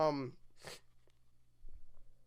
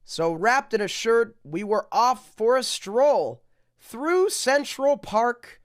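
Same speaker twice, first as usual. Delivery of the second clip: sing-song rhyming read-aloud; storybook reading; 6 semitones higher, 7 dB louder than usual. Acted. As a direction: read it like a bedtime picture book. Reads rhyming picture book aloud.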